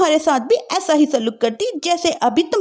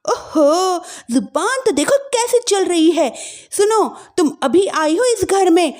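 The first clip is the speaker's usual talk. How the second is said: higher, haughty warning; threatening sister-in-law; 7 semitones higher than usual. Acted. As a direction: scold the new bride.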